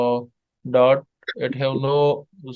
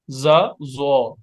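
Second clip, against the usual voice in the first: ordinary delivery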